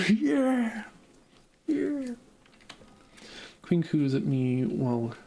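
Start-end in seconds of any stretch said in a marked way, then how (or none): 0.1-2.1 excited cheer. Real, not acted. Cheering after game praise.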